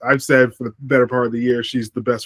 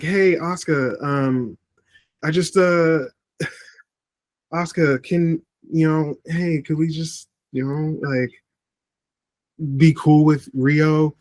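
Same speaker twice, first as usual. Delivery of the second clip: higher, hesitant pleading voice; timid request; 3 semitones higher than usual. Retold or imitated. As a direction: ask nervously, as if tiptoeing around her. Voicing a hesitant wrestler asking.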